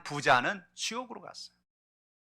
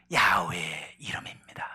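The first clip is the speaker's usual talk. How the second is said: slow, lower whisper; a reverent, slow whisper; hushed whisper; 11 semitones lower, slower than usual. Acted. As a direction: drop to a whisper for the answer. Climactic whispered answer.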